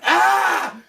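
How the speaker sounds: sudden high, loud yell of overwhelm; frustrated yell. Retold or imitated. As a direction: blurt out a stressed shout. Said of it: "And I'm just like".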